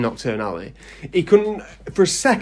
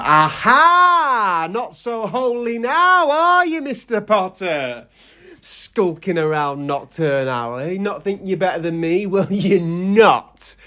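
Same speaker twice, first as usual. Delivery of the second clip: much higher, mocking triumph, slow at end; gloating Hagrid; 7 semitones higher than usual. Hagrid gloating at Harry in Knockturn Alley, high and mocking. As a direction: gloat like a vindicated giant.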